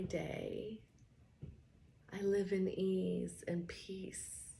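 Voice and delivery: slow, soft affirmations, often whispered; guided affirmations. Acted. Coach leads calming I am affirmations, slow and soft. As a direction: ease them out calmly, almost breathing them.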